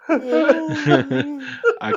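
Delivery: laughing. Real, not acted. Laughing at the scene.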